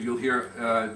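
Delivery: ordinary talk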